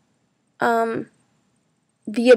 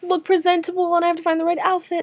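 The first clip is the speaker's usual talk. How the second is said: higher, rushed list of worries; mock panic; 6 semitones higher than usual. Student retelling panic over an application deadline, mock frantic. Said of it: "I'm freaking out because I'm like".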